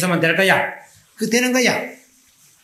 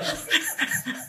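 high unvoiced laugh; 8 dB quieter than usual. Real, not acted. Laughs after retelling.

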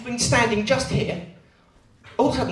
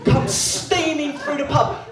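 ordinary talk, nothing marked

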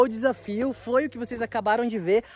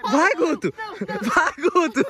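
excited cheering, higher and brighter; 6 semitones higher, slower than usual. Real, not acted. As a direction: cheer him on across the water. Cheering friend across lake.

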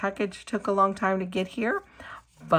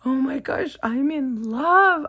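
excited; 5 semitones higher, 6 dB louder than usual. Real, not acted. Swooning over charms.

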